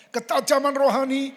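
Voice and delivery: loud, lifted group reading; preaching at full force. Acted. Preacher leading the congregation's reading, loud proclamation. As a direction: proclaim it to the whole church. Congregation reads slide aloud together.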